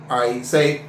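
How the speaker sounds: ordinary delivery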